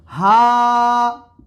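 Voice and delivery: हा with a long drawn ā; long ā demo. Shown as a demonstration. Teacher reading an Arabic word aloud for students. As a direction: stretch the ā in हा. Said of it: "होता है एक word".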